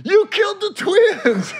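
shocked high accusation; high, slow accusing voice. Pretend. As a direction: accuse him, shrill and slow. Acting out the killer's accusation.

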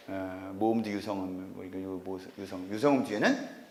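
ordinary delivery

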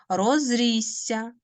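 «Розрісся» slow, the СС held long; long doubled S. Shown as a demonstration. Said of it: "Корінь у нас який буде". («Розрісся» повільно, з подовженим «сс»)

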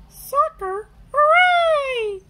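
very loud high cheer. A narrator's reading. The friends cheer at the idea of soccer, loud and high. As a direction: burst out in joy. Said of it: "everyone cheers".

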